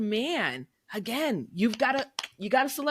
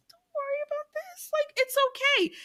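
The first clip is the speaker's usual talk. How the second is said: high comforting. Retold or imitated. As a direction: coo it high to soothe him. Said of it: "I'm like".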